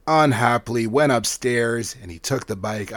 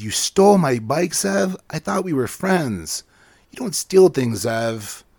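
hurt indignation; 4 semitones higher, 4 dB louder than usual. Acted. Murray hurt by theft.

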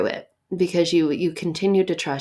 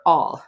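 "all." said alone and punched louder; stress on all; 6 dB louder than usual. Shown as a demonstration. Preacher isolating the key word, punched louder. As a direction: hit "all." hard as a single word. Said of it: "notice the emphasis on the key words there".

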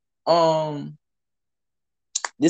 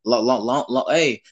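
ordinary delivery

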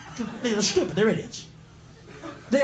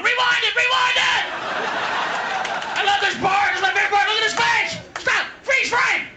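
put-on drunk gleeful generals; 11 semitones higher than usual. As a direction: whoop like drunks replaying a highlight. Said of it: "generals were getting drunk at night going".

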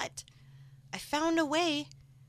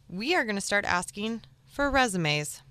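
ordinary talk, nothing marked

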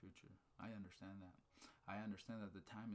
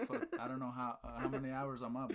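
laughing; 2 semitones higher, 8 dB louder than usual. Real, not acted. Getting fired up, laughing.

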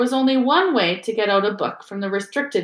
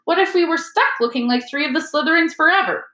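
higher, louder anxious question; alarmed worry; 8 semitones higher, 5 dB louder than usual. Acted. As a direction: fret about the worst case. Ron worries about being stuck.

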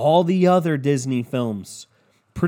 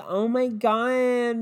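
higher, slow "Oh my God" that sags away; shop vac shutting down; 8 semitones higher, much slower than usual. Shown as a demonstration. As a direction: let it die out like a shop vac powering off. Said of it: "like a shop vac shutting down".

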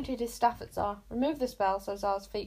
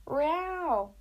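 cat roar; 6 semitones higher, 4 dB louder than usual. A narrator's reading. Cat roars.

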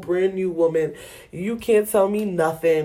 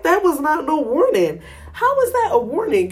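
high, indignant protest; indignant retort; 8 semitones higher than usual. Retold or imitated. To Jeff about the warning, indignant. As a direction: protest it, outraged.

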